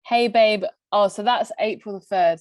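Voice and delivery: ordinary talk, nothing marked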